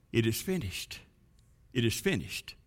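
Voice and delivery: very soft, low hush; hushed whispering. Acted. Blood drops tell the grass, hushed and whispering. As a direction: drop right down to a near-whisper. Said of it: "whispered to the grass".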